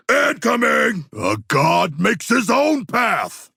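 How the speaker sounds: high, slow ringing shout; battle shout. Acted. Proud fighter shouting in battle, high slow shout. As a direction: bellow it like a proud god.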